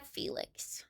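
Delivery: whispered name. Real, not acted. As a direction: breathe the name softly. Reading episode name softly.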